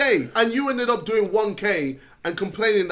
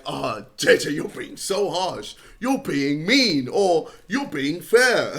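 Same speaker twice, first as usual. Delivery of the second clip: mock whiny critic; 8 semitones lower, slower than usual. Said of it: "we were gonna be like".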